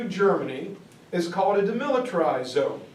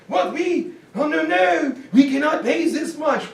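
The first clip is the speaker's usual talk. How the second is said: panicked protest; 7 semitones higher than usual. Retold or imitated. Allies panic over the bill.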